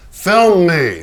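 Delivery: showy demand from the painter. Acted. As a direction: insist on being filmed. Pollock demanding to be filmed.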